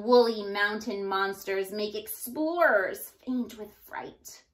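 plain reading voice

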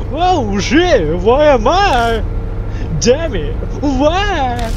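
high, loud, squeaky clown voice; funny clown voice. Acted. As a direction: shriek it like a goofy clown. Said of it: "in a very funny clown voice".

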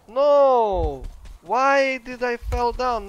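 loud, slow cry of dismay; real loud groaning complaint, drawn out; frustrated shout. Real, not acted. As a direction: yell it out at the fall. Falls and gets injured.

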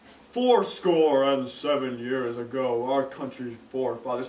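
low, slow, grand speech voice; solemn orator. Acted. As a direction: deliver it like a slow, grand old statesman. Lincoln giving his speech.

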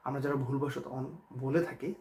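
ordinary talk, nothing marked

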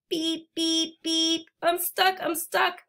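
a hint of a small worried truck; little blue truck. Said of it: "cried little blue truck".

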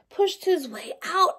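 ordinary delivery, nothing marked

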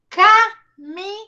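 slow teacher demo; syllable by syllable. Shown as a demonstration. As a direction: draw out ca-mi-nhão for the class. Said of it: "Vamos lá, ó".

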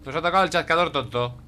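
loud, fed-up insults at a useless ally; exasperated yelling. Real, not acted. Scolding the useless clicker ally in the game, loud exasperated shouting. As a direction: bark frustration at the dumb clicker.

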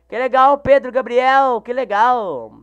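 excited reply to a viewer playing along. Real, not acted. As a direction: beam at the viewer.

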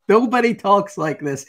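high, loud indignant outburst; mock outraged protest; retold telling-off. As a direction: imitate her going off on him. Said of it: "she went off on him".

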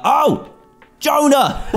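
high, loud cry of a name; startled shout of the name; alarmed call. Real, not acted. Penguin falls over.

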